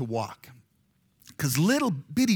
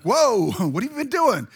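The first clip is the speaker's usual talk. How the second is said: laughing surprise, higher and louder; surprised whoa; 6 semitones higher, 5 dB louder than usual. Retold or imitated. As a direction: caught off guard and delighted. Surprised at baptism request.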